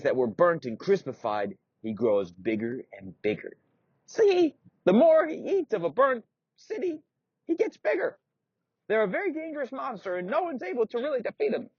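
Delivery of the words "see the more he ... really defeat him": put-on very high, creaky old-man squeak; a doddery old knight, indignant; old knight insists he is still strong.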